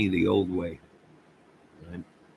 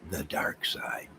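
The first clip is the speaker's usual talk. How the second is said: a hushed, spooky aside; whispered; 9 dB quieter, slower than usual. Real, not acted. Telling viewers he left the dark side, whispered. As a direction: whisper the words.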